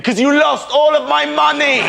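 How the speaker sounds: slow, very high outraged yell; furious shout. Acted. Customer to the chatty bank manager, high furious shout. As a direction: build it into a drawn-out screech.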